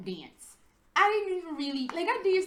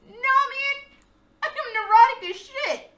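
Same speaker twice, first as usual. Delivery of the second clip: loud, higher insistence; emphatic protest; 10 semitones higher, 9 dB louder than usual. Real, not acted. Protesting to viewers that she's not cool, loud and high. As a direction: shout down the compliment.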